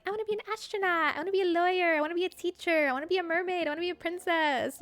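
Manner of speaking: put-on high, excited kid voice listing dream jobs; playful child impression; childlike voice. Imitating kids' dreams.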